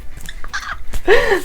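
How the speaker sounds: a real, high, giggly laugh. Real, not acted. Showing viewers her growing eyebrows, a high real laugh.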